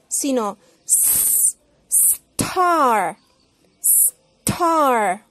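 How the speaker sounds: s- stretched then star, with no e in front; no E before S. Shown as a demonstration. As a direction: start star straight on the s. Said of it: "no podemos decir E".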